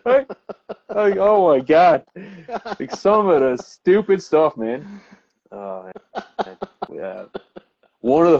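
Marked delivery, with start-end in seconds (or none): none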